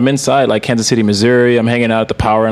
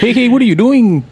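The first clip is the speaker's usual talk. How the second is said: high-pitched mock Punjabi-East LA accent; hammed-up Punjabi East LA accent; 12 semitones higher than usual. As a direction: put on a high Indian-meets-East LA accent. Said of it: "Punjabi East L A accent".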